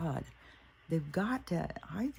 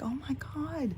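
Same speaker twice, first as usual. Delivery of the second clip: mock gasp; 12 semitones higher than usual. Mocking the shocked press.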